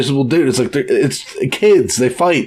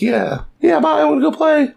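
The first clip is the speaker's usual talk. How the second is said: put-on much higher, bouncy child voice; eager kid. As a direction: rush off like an eager little kid. Child Vivi rushing off.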